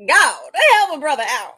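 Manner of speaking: a hint of a tired warrior begging God; imagined farmer praying. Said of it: "Middle Eastern gentleman".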